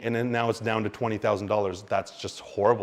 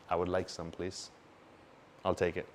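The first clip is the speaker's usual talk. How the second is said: much softer, unbothered politeness; calm buyer; 5 semitones lower, 10 dB quieter than usual. Retold or imitated. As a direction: ask for it coolly while everyone panics. Calm buyer amid fear.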